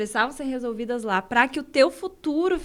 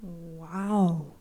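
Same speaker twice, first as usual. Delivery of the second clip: low, put-on wow; disguised deep voice; 6 semitones lower, 6 dB quieter than usual. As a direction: drop the voice for a mock wow. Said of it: "Espera que eu vou mudar a voz de novo".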